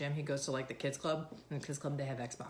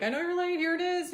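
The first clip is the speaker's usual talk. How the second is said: imitating husband; 13 semitones higher, 7 dB louder than usual. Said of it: "He's literally like".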